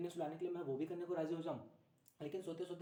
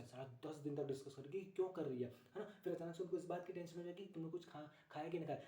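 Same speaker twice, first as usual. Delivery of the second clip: ordinary talk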